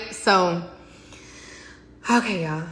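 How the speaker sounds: usual speaking voice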